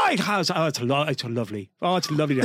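imitating an Irish phone greeting; Irish accent. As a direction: gush on a call home in a put-on Irish accent. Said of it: "you have to hear her call somebody in Ireland".

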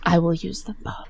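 hushed, breathy stylist line; whispered Franca. Retold or imitated. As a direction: whisper it like a revelation. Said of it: "And Franca's like".

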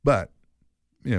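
ordinary delivery

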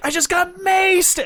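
high shrieking yell of a maced protester; mock scream. Protester yelling after getting maced, high shriek.

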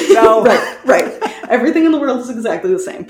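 quick, laughing mock agreement; laughing sarcasm. Real, not acted. To her co-host, joking that nothing changes, laughing fast. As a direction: rattle it off fast through a laugh, tongue in cheek. Sarcastic reply about nightgown outrage.

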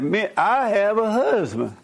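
drawn out, put-on voice; imitating the guest. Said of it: "He said".

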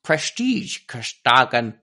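slow, slushy Dutch accent gone Sean Connery; attempted Dutch accent. Shown as a demonstration. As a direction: have another go in a hammy Dutch accent. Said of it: "try and do it in the accent".

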